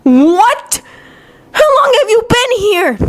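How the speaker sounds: high-pitched, astonished question; flabbergasted squeak; gaping disbelief. A narrator's reading. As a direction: gasp it out, pitch leaping up. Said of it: "Kiba gapes".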